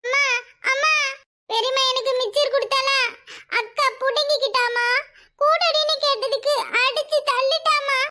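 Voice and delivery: put-on whining, crying child with dragged word endings; tearful acted complaint. Child telling mother about the shove, crying. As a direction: whimper the tale out. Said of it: "என்னமா அழுது நடிக்குது".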